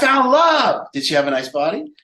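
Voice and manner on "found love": high, loud burst of triumph; excited cry. Acted. Seeker boasting of love found, excited high shout. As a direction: shout it out like a thrilled lover.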